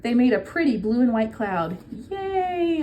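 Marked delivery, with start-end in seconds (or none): 2.1-2.8 high, excited cheer; cheering yay. A narrator's reading. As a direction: cubs cheering as caps fly. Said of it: "all the Cubs yelled".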